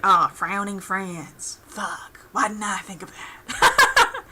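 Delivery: slow, drawn-out cartoon boss voice, breaking into a laugh; slow boss realization. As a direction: savor it like a villain getting a genius idea. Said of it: "sees, like".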